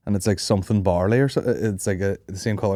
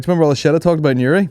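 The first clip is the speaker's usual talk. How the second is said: fast mock stage line; 7 semitones higher, 5 dB louder, much faster than usual. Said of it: "walk on stage and be like".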